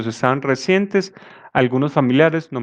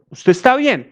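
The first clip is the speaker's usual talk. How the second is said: caricatured envy; 9 semitones higher than usual. Retold or imitated. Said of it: "haciendo una caricatura".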